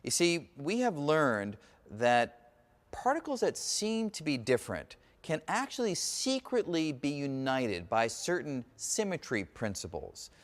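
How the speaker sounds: normal talking voice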